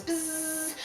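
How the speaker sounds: high, soft buzzing; flea noise. Shown as a demonstration. Drama teacher shows class the flea noise, buzzing. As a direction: buzz like a flea. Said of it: "a flea makes this noise".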